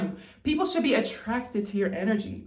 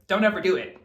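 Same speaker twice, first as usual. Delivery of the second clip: ordinary talk, nothing marked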